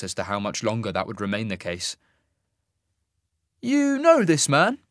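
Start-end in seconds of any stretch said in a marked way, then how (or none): 3.6-4.8 high, slow, loud question; questioning immortal. A narrator's reading. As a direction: ask it in a lofty raised voice. Isaac questions the giant.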